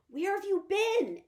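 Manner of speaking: high, loud, slow worried question; urgent call. Acted. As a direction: call down the hole, anxious. Boy calls into the hole.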